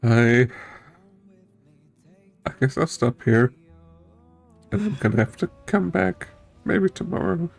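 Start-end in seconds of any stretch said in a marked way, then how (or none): none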